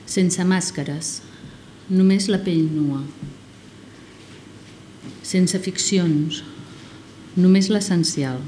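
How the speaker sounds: slow, deliberate poetry reading; poem recitation. Acted. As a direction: recite line by line with long pauses.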